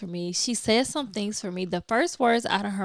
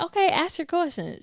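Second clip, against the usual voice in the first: higher, bracing yourself; resigned go-ahead; 9 semitones higher than usual. Retold or imitated. To the young lady who hesitates, resigned. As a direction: sigh it out and let her ask.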